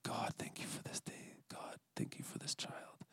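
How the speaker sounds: whispered short thanks to God; very soft breath-only prayer; barely-voiced prayer. Shown as a demonstration. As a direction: whisper it privately, hardly any sound. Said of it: "just barely on my lips".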